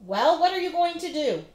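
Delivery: loud, high demanding shout. Acted. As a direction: shake your fists as you yell. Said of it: "the man shouted".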